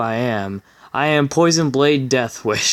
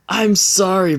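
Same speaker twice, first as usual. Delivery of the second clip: mock Luna voice; 7 semitones higher than usual. Luna's voice quoted in the fic.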